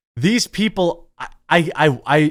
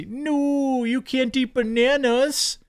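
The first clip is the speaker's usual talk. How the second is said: mock whiny protester; 11 semitones higher, slower than usual. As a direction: squeak it like a fussy kid. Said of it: "And then he's".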